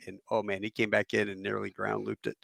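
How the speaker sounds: ordinary talk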